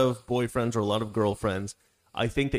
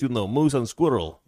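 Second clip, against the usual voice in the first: put-on Natasha's Russian accent; 2 semitones higher, 5 dB louder than usual. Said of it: "she would talk like this".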